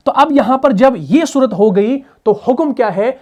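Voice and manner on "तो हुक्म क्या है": normal talking voice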